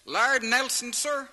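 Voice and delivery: taxi driver. Retold or imitated. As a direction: chirp it up like an eager cabbie. Taxi driver voiced in story.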